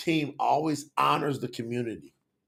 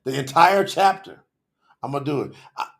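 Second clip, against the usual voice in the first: ordinary talk, nothing marked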